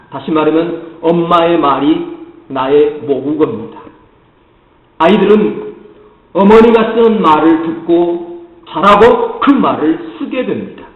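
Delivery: ordinary delivery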